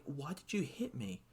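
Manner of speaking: small, soft mock child voice; hurt child. Imitating a wrestler just slapped by his mentor, hurt child. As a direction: imitate a betrayed child, very quietly. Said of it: "looks like a child who just got".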